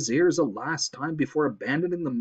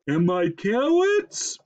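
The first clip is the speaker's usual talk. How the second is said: slow, drawn-out moose query; slow, raised animal voice; 5 semitones higher, 4 dB louder, slower than usual. A narrator's reading. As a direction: stretch it out, rising high. Moose calls out while running.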